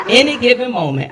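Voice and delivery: words laughed out high and loud; laughing. Real, not acted. Joking about her boss firing her, laughing. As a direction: keep laughing through it.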